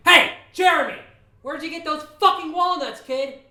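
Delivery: high, furious shout across a distance; angry yell. Retold or imitated. Angry neighbour yells at kid across the street, furious. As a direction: yell it out, pitch and volume way up. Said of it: "I got really angry, and I yelled out".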